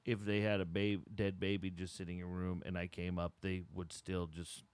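quiet and sad. Acted. Sad old salesman confiding.

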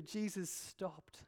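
hushed dramatic pause in a sermon. Real, not acted. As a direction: drop almost to a hush and draw it out.

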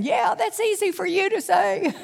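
high, sarcastic scoff; skeptical disciples. Acted. Disciples' skeptical reply voiced.

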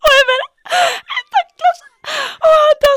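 high, loud laugh-cry; slow, cracking into laughter; laughing in disgust. Real, not acted. Laughing about the pepper piece.

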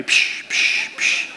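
hissed air-freshener spray bursts; short voiceless puffs like a spray can; imitated spray. Preacher picturing potpourri and spray, imitated spraying. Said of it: "I think about potpourri and spray".

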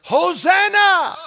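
loud high hosanna shout; crowd's cry. Retold or imitated. Crowd crying out to Jesus, loud joyful shout. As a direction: ring it out like the crowd at the gate. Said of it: "and they cried".

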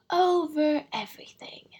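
high, loud, grandly sweeping answer; magnificent simplicity. A narrator's reading. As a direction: pronounce it grandly, like a king. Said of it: "said the king with magnificent simplicity".

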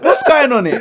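loud high laughing call-out; laughing mock shout. Joking mock challenge to guest.

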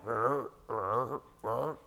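soft, low rolled croaking; low frog croak. Acted. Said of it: "you hear one over here going".

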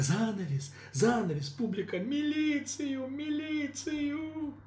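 high drawn-out panicked cries; panicked shouting. Acted. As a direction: scream for help in panic.

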